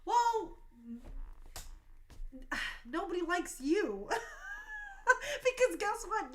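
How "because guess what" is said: a high, teary laugh breaking into the words. Real, not acted. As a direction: laugh through tears as you turn on the critics. Emotional rant at critics.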